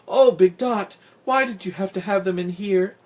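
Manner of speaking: high-pitched dismayed reproach; distressed pleading question. A narrator's reading. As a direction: wail it in dismay, starting loud. Narrator cat dismayed at kittens.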